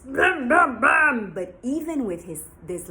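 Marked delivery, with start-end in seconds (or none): 0.0-1.3 loud brum engine sound; motorbike noise. Acted. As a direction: rev like a motorbike. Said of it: "a very loud noise".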